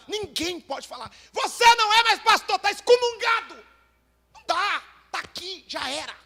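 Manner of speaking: roaring pulpit cry; fiery preaching. Acted. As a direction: preach it hard to the pastors. Preaching at full force.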